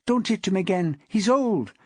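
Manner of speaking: high-pitched woman's coaxing voice; hurried pleading. A narrator's reading. Wife talking her husband out of another blow, hurriedly pleading. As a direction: plead quickly to hold him off, coaxing. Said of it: "she hurriedly continued".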